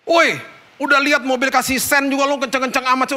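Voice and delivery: retold roadside scolding; imitating angry bikers. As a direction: shout him down like an angry biker on the road. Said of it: "pada marah-marahin dia".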